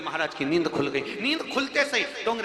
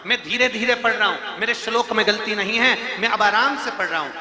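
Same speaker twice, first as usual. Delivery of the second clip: emphatic insistence; 7 semitones higher, 6 dB louder than usual. Retold or imitated. Brahmin repeats, pleased and emphatic.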